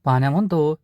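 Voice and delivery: ordinary delivery, nothing marked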